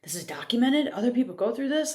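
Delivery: gasping wonder at finding herself described; reading the awakening article, astonished and rising; retold amazement.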